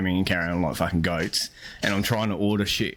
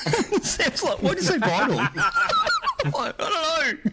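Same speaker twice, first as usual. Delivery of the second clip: much higher, laughing through the words; giggling at herself. Real, not acted. Telling the mates about saying bye at the drive-through, laughing.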